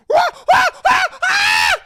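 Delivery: loud, high ape screeches, building to a long scream; big apish howling; gorilla roar. Acted. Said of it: "the fucking gorilla immediately is like".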